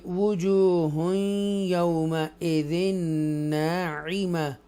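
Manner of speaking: slow melodic tajweed recitation; Quran recitation. Shown as a demonstration. Teacher recites the verse for students, slow melodic chant. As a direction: recite slowly, vowels stretched.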